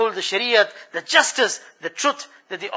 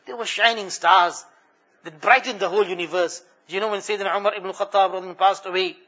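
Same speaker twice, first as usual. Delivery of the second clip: ordinary talk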